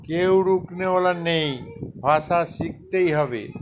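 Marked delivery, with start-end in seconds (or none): none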